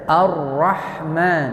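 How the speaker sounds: slow "Ar-Rahman" with a long doubled r; tasydid on ra. Shown as a demonstration. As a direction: hold the r in "Ar-Rahman", no l. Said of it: "dikasihlah tasydid pada huruf ra". ("Ar-Rahman" pelan, ra ditekan bertasydid)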